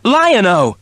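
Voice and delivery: high panicked shout of a name; panicked cry. A narrator's reading. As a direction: call out in sudden panic. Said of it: "cried Wily Cat in a panic".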